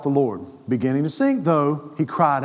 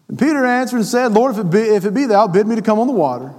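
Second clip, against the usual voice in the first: higher, louder pulpit voice reading scripture; full-force preaching; 6 semitones higher, 4 dB louder, faster than usual. Acted. Preacher reading the scripture aloud to the church, fervent. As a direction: preach it out to the congregation.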